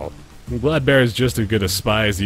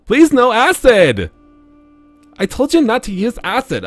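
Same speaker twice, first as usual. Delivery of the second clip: put-on valley girl; 12 semitones higher, 4 dB louder than usual. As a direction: whine it like a California girl. Reading Katie's protest.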